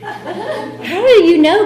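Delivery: amused, drawn-out disbelief; incredulous question. Retold or imitated. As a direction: ask it as if they can't possibly know. Incredulous at the members' welcome.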